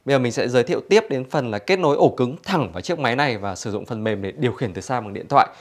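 ordinary talk, nothing marked

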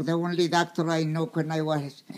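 usual speaking voice

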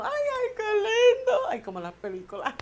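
melting with giddy delight; swooning laugh. Real, not acted. To viewers, dreaming of a romantic date, swooning laughter.

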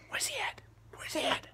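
quick whisper off to the side; whispered aside. Real, not acted. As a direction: whisper where he is. Stage whisper aside to helper.

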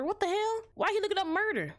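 sudden high startled outburst; shocked. Real, not acted. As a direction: jump up in pitch, genuinely thrown. Shocked by murder searches.

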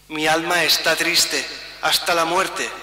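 normal talking voice

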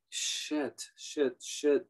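under-breath swearing; muttered curse. A narrator's reading. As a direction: hiss the curses quietly. Machine froze, swearing.

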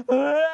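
loud hammed-up child's cry; mock wail. Gundul still bawling at the villagers, loud mock wail. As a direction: bawl again.

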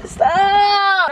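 high, drawn-out yell; exasperated yell. Real, not acted. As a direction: yell it out to make them back off. Fending off partner.

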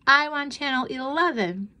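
high-pitched rival demand; demanding child. A narrator's reading. Twin contradicting her sister about television, demanding. Twins fighting over the television.

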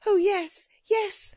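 put-on high, urgent child's voice; urgent agreement. A narrator's reading. Girl jumps at the chance to go home, urgently. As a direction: agree eagerly, pitched well up. Jane eager to escape.

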